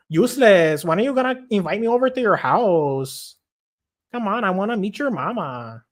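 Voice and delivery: high, wheedling woman voice; torta woman impression. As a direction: pester a reluctant boyfriend in a put-on high voice. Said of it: "my fucking torta impression".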